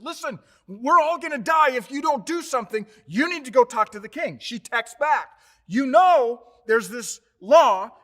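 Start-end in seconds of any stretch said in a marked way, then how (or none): 0.0-4.4 higher and louder, pressing hard; urgent plea. Acted. Mordecai urging Esther to go to the king, loud and urgent. 5.7-8.0 louder, with "this law" slowed and stressed; emphatic objection. Acted. Esther pushing back at Mordecai, loud and slow.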